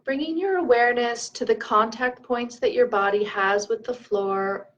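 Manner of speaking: calm meditation leader, leaving room to breathe; guided meditation. Acted. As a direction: lead them gently through the breath, unhurried.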